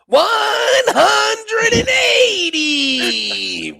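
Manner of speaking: over-the-top Yank caller calling a maximum; mock hillbilly darts caller. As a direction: bellow the score like a brash American announcer. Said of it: "this hillbilly Yank comes on".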